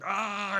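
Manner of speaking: high mock roar of rage; a high, loud fake roar over the broken record; angry monster yell. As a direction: yell like a furious creature. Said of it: "He's like".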